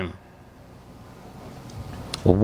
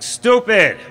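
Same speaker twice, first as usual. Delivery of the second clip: mock jeer; 10 semitones higher, 6 dB louder than usual.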